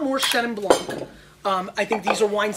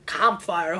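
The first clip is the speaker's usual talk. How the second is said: "Campfire" with a lower, foreign-sounding vowel, "kahm-fire"; odd accidental accent; 4 semitones lower than usual. Pretend. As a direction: let "Campfire" slip into a stray accent. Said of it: "What accent did I just get there?".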